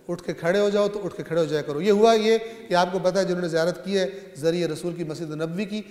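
ordinary delivery

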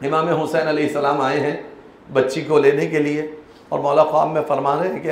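normal talking voice

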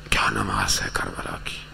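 low, voiceless whisper of reverence; hushed awe. Real, not acted. Preacher marvelling at the prayer of Karbala, hushed. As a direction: drop to an awestruck whisper.

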